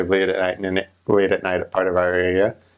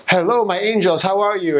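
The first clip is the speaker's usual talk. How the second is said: high, syrupy voice of an overjoyed church lady; high-pitched evangelical lady greeting the missionaries; gushing church lady; 11 semitones higher than usual. Retold or imitated. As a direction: pile on the sweetness, up high. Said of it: "and she's like".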